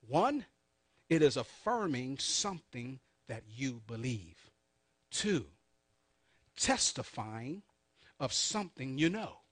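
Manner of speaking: numbered points with long pauses; said slowly. Shown as a demonstration. Preacher goes back over the points for note-takers, said slowly. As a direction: dictate it slowly, letting each point stand alone. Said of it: "I'm gonna say this slowly".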